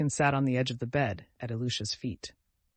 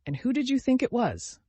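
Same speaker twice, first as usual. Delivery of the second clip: much higher, ringing and joyful; ringing happy shout; 11 semitones higher, 4 dB louder than usual. Acted. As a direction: shout it out with delight. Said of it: "shouted with all his might in a ringing, happy voice".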